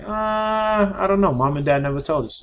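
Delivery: high, unsure big brother snake. Acted. Big brother snake answering his little brother, unsure and high.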